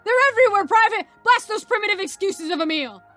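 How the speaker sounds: high, loud frantic order; battle shout. Acted. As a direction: shout over the chaos. Sergeant orders attack.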